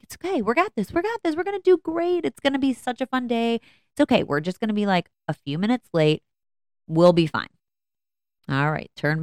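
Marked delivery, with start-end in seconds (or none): 0.0-7.5 bright, much higher sing-song soothing; forced reassurance. Retold or imitated. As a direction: mom calming her freaking-out kids in the car, high cheerful reassurance. Mom reassuring freaking-out kids.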